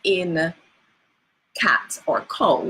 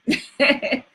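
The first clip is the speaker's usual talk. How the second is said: light laugh; 7 semitones higher than usual. Real, not acted. Teacher laughs at own question.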